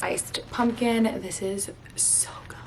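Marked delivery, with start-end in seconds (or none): 1.2-2.6 unvoiced hushed whisper of delight. Real, not acted. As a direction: whisper it like a secret treat. Whispered tasting remark.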